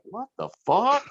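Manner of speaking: shocked outburst. Retold or imitated. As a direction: pitch jumps up on a stunned curse. Retold shock at photos.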